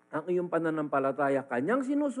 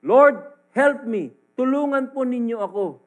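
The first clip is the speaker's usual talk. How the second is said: pleading prayer; 5 semitones higher, 10 dB louder than usual. Acted. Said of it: "basahin niya".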